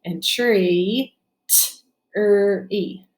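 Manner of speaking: "tree" said whole, then split into "t, er, e"; teacher isolating each sound in "tree". Shown as a demonstration. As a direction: segment "tree" into three separate sounds. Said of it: "Three sounds in the second syllable".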